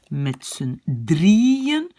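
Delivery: "Met z'n drieën" very slow, long rising DRIE; accent sur le I. Shown as a demonstration. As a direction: swell on the IE of "drieën", word by word. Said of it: "l'accent est sur le I". (« Met z'n drieën » très lent, DRIE allongé)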